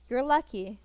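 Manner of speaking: normal talking voice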